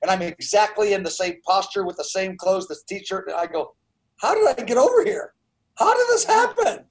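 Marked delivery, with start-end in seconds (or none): 4.2-6.9 high, rising bewildered cry; astonished disbelief. Retold or imitated. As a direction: gasp it out, baffled at where you woke. Waking up bewildered, retold quote.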